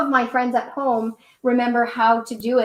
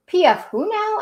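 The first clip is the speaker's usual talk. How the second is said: puzzled question; 7 semitones higher than usual. A narrator's reading. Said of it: "asked Rosie".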